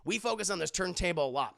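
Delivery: ordinary talk, nothing marked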